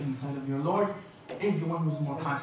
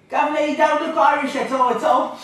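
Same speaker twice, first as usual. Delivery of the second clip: high, loud hammed-up Cockney imitation; mock Cockney accent; 11 semitones higher, 9 dB louder than usual. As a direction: play a cheeky London cabbie. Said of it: "I go to London".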